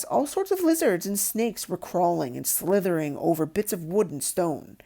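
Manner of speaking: ordinary delivery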